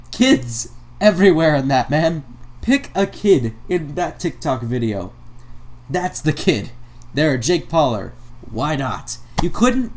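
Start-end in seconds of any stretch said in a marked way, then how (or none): none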